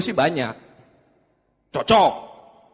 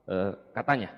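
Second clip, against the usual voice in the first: sheepish reply; 13 semitones lower, 9 dB quieter than usual. Retold or imitated. Young man to his brother, admitting it sheepishly and quietly. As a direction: lower and softer, caught out.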